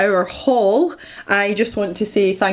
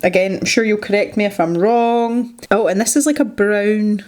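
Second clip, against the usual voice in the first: ordinary delivery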